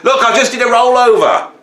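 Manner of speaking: high, loud, put-upon dog; indignant pet complaining; fed-up dog voice. Retold or imitated. Dog complaining it already did a rollover, high and indignant. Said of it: "voicing the dog".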